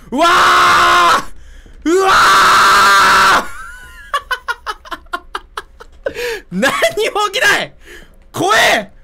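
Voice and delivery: high, loud, drawn-out scream full of laughter; laughing mock scream. Real, not acted. Streamer laughing at nothing happening, mock scream. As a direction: shriek in fake terror while laughing. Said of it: "こういう怖さ".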